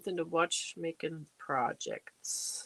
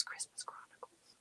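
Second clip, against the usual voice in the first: Christmas Chronicles breathed out softly, no voice; whispered to herself; 19 dB quieter than usual. Real, not acted. Streamer whispering a viewer's movie suggestion to herself. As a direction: whisper it under your breath to remember it.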